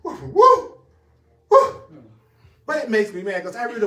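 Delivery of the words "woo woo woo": high, loud laughing whoops; excited whoop. Real, not acted. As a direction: cheer like the point just landed. Excited whoop after book's point.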